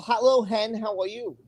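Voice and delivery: ordinary delivery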